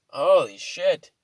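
ordinary delivery

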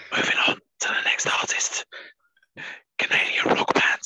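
whispered mock-creepy announcement; hushed, voiceless host intro as a joke.

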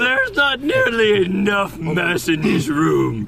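slow, drawn-out proclamation rising high; hammy grandiose villain. Pretend. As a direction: declaim like a mad overlord. Mock villain declaring a mission.

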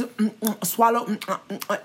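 quiet gulping mouth noises; exaggerated swallowing sounds between words; imitated swallowing. Mocking the apology video's noisy swallowing, imitated gulps. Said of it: "A S M R Ts".

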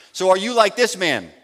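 ordinary delivery

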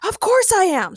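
high, indignant character shout. A narrator's reading. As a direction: exclaim it as if it were obvious. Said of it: "shouted Ray".

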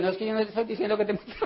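rattled off very fast, casual; rapid reply. Retold or imitated. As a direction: brush it off in one quick breath. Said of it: "Entonces yo le digo". (respuesta rapidísima y casual)

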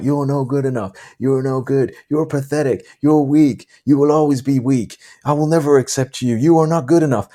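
put-on taunting the kid, half-performed; retold shouting. Prisoners taunting the kid as he pushes through, mild mock yell. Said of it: "the guys are all shouting at him stuff like".

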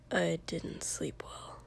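slow, hushed mutter. A narrator's reading. Boy confides to friend at the table, muttering low. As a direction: mutter it under your breath, nearly whispered. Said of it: "Shoto muttered".

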